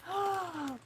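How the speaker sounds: soft gasp of delight. Real, not acted. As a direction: gasp at something lovely.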